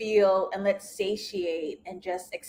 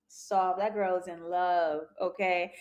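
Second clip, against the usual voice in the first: tender hush; 4 semitones lower, 7 dB quieter than usual. Real, not acted. Melting over the couple.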